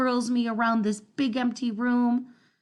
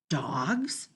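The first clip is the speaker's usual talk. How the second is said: hushed as if the floor might hear; scared whispered aside; fearful whisper; 7 semitones lower, 4 dB quieter than usual. A narrator's reading. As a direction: breathe the word out, unvoiced and low. Dog narrator's fearful realization.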